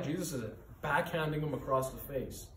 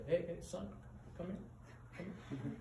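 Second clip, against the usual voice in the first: calm father calling; 6 semitones higher, 9 dB quieter, faster than usual. Retold or imitated. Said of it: "what does the father say?".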